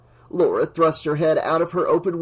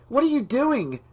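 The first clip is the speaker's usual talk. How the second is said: high alarmed question; startled, worried outburst; 10 semitones higher than usual. A narrator's reading. Dangerous U-turn, alarmed passenger.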